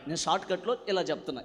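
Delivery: usual speaking voice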